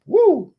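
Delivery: high, loud "woo"; whooped exclamation. Real, not acted. Streamer amazed by a day of donations, high whoop. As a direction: whoop it out mid-sentence.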